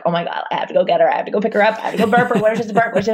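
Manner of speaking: quick anxious babble, pitch climbing; retold panic. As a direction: rush it like a panicked new mom. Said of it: "I'm like".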